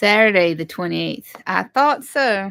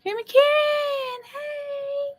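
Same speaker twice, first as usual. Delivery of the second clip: very high, slow, drawn-out hello; high sing-song greeting. Acted. Greeting the livestream viewers in a very high sing-song voice. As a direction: squeal the greeting up high like a cooing grandma.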